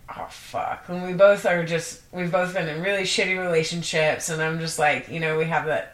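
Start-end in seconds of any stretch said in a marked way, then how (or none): 0.1-0.8 unvoiced scared whisper. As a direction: whisper it in dread. Retold fearful reaction.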